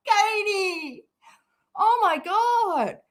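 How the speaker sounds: high, drawn-out wail after laughing; helpless amused exclamation. Real, not acted. Teasing Katie after a laughing fit, high amused wail.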